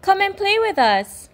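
high, bright fish voices calling. Acted. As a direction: call him over to play.

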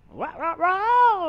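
sad trombone. Pretend. Mocking a roadkill defeat.

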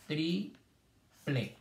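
"Triple" slowly as tri, long pause, ple; syllable by syllable. Shown as a demonstration. Teacher showing first and last syllable, "Triple" split apart. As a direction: leave a clear gap inside "Triple". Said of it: "no hay sílaba en el medio".

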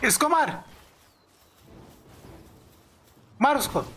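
high, short attack order; urgent command. Real, not acted. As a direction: urge your fighter on from the sidelines. (जोश में ऊँची आवाज़ में हुक्म)

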